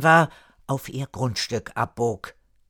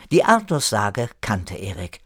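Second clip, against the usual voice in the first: ordinary delivery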